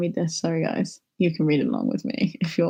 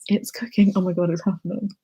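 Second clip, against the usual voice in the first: usual speaking voice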